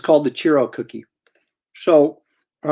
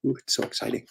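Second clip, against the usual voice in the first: breathy whisper, playfully excited; whispered mock excitement; 11 dB quieter than usual. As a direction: whisper it like a big secret. Mock excitement opening box.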